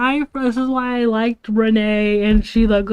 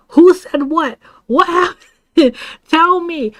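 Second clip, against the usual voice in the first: high, raised-voice mock interrogation; angry demand; 7 semitones higher, 4 dB louder, slower than usual. Friend demanding to know who mocked the speaker, angry. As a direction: act out a friend demanding answers. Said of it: "very angry".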